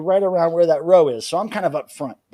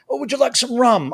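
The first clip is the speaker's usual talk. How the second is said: higher mock offer; 8 semitones higher, 3 dB louder than usual.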